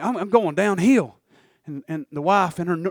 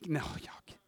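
breathy whisper to the front row; hushed aside to the room; 7 semitones lower, 18 dB quieter than usual. Real, not acted. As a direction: drop it to a secret whisper. Aside to congregation.

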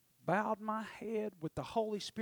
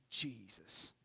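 whispered, lower thank-you; a low relieved whisper; 8 semitones lower, 10 dB quieter than usual. Real, not acted. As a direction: breathe it out in relief. Relieved aside to himself.

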